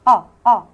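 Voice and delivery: أ said short and loud; punched vowel sound. Shown as a demonstration. Teacher punching أ for the class. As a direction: hit the أ sound hard. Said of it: "اليو نطقه أ".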